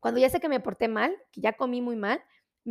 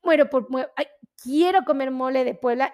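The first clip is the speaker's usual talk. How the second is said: ordinary delivery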